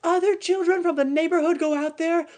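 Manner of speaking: put-on a mother suddenly frightened for her children; alarmed. Mother alarmed about the badger.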